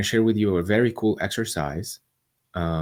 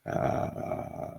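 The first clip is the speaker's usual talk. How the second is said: vocal fry; 10 dB quieter than usual. Shown as a demonstration. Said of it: "allow gravity to pull down the vibration".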